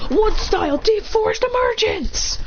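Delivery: slow, high-pitched technique call; acted attack call. Fighter announcing a wood jutsu, mock battle yell. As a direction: draw it out like an anime attack.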